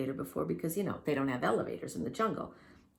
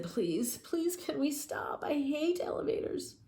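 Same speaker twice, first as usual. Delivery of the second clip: high, weak sickly plea; sick pleading; 10 semitones higher, slower than usual. Acted. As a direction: beg to stop, queasy. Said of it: "felt very, very sick".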